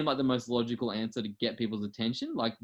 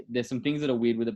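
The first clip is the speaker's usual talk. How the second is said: ordinary talk, nothing marked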